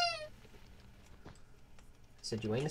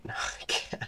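ordinary talk

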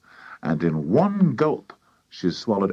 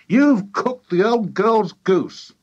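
high, loud, slow scolding; exasperated high-pitched wife; glaring accusation; 11 semitones higher, 5 dB louder, slower than usual. A narrator's reading. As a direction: scold a husband in a high, drawn-out cry. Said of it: "glaring at her husband".

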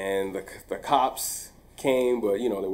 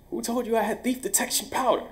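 high, fast, defensive retort; 12 semitones higher, 3 dB quieter, faster than usual. Retold or imitated. Teacher cornered by the office worker, fast defensive high retort. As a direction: fire it back fast and high, on guard. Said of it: "I was very defensive".

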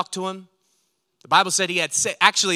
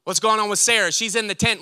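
ordinary delivery

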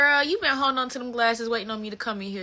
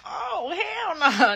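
crying protest; 11 semitones higher, 8 dB louder, much slower than usual. Retold or imitated. Reacting to grandma's death.

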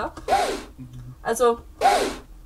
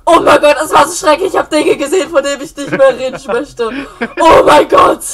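loud, disgusted exclaiming; horrified shouting; 9 dB louder than usual. Real, not acted. Disgusted after titan.

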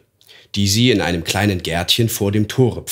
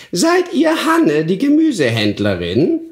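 put-on croaking old hag; 11 semitones higher, 3 dB louder than usual. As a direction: creak it out like a nasty old crone. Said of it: "mit unangenehmer, krächzender Stimme".

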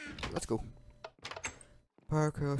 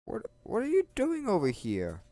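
high startled what are you doing; high-pitched baffled question; surprised question; 11 semitones higher than usual. Real, not acted. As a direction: catch the dog somewhere odd, surprised. Surprised at dog.

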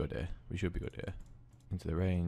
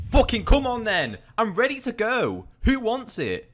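high, loud fired-up yelling; hyped shout; 13 semitones higher, 9 dB louder than usual. Real, not acted. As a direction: roar for a fight. Hyped during a fight.

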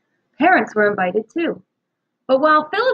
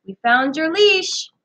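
calling; 7 semitones higher, 3 dB louder, slower than usual. A narrator's reading. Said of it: "Annie called across the field".

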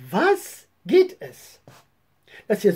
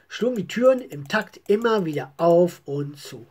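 usual speaking voice

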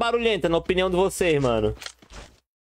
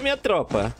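usual speaking voice

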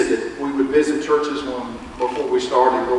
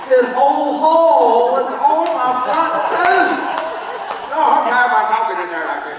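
laughing; 11 semitones higher than usual. Real, not acted. Laughing at caper in tooth.

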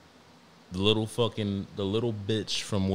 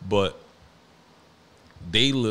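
normal talking voice

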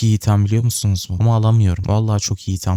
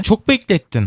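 mock scolding exclaim; 8 semitones higher than usual. To the slow item it waited on, a high mock scold. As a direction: fling the complaint up high.

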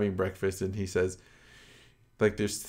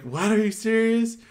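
much higher, louder disbelief; mock incredulous; 12 semitones higher, 5 dB louder than usual. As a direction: imitate someone taken aback by a proposition. Retold woman's incredulous reply.

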